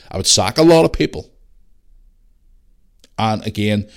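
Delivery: ordinary delivery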